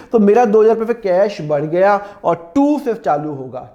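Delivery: ordinary talk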